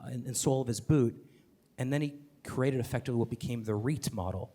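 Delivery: ordinary delivery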